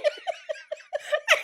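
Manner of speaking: helpless high-pitched laughter; high squealing laugh. Real, not acted.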